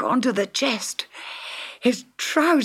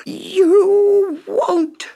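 shrill, very high furious shriek; screaming witch; 13 semitones higher, 7 dB louder than usual. A narrator's reading. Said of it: "screamed the witch".

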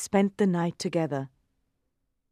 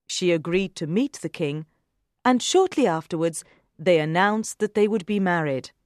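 usual speaking voice

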